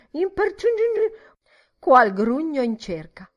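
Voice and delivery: high, loud grotesque snorting voice; snorting mimicry. A narrator's reading. Miss Deuce mimicking the old man, loud snorting. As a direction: snort it out through the nose. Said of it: "stronfiava su e giù per le narici".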